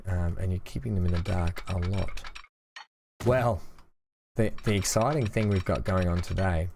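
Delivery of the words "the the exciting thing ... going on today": usual speaking voice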